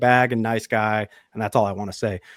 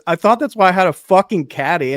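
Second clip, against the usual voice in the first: higher and louder, indignant snap; mild retold outburst; 6 semitones higher, 7 dB louder than usual. Friend snapping back at the caddy, hot and higher. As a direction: fire it back at the caddy, half-laughing. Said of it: "he goes".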